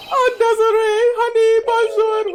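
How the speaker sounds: high feminine voice. Acted. Voicing Desiree character.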